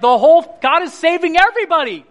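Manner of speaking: high, exclaiming wonder; overjoyed outburst; amazed exclamation. Retold or imitated. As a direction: marvel out loud, pitch soaring. Marvelling at family conversions.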